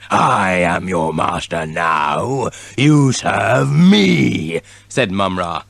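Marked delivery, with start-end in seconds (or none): 0.1-4.6 villain voice. A narrator's reading. Villain commanding.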